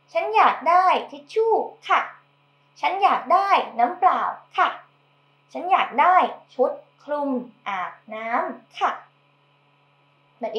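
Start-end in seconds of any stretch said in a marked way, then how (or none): 0.1-8.9 quick, a bit higher example sentences; model sentences. Shown as a demonstration. Teacher modelling a woman's polite requests. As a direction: rattle off each full sentence. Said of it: "If you're a woman".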